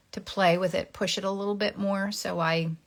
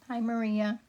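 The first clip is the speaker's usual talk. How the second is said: ordinary delivery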